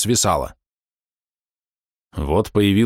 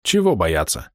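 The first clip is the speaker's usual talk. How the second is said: ordinary delivery, nothing marked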